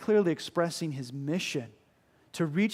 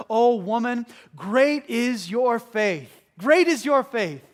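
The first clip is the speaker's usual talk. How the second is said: louder, higher booming praise of faith; proclaiming loudly; 6 semitones higher, 8 dB louder, slower than usual. Acted. Jesus praising the woman's great faith, booming proclamation. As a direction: ring it out like a proclamation.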